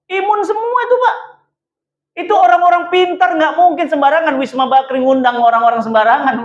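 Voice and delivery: high, insistent pulpit delivery; impassioned preaching. Real, not acted. Preacher vouches for the invited teachers, impassioned.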